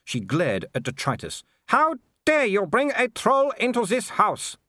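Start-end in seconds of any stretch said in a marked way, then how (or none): 1.7-4.6 high, slow outraged Baroness; indignant. A narrator's reading. Baroness objects to the troll in her house, high outrage. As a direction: rebuke them haughtily, pitched far up. Baroness rebukes intruders, glaring.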